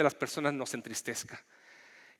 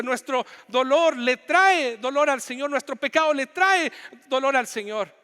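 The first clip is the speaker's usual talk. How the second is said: high, loud, impassioned pulpit delivery; Chilean pastor pleading with his church, preaching at full force; 10 semitones higher, 5 dB louder than usual. Acted. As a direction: preach it out to the congregation, building fervor. Climax of sermon, pleading.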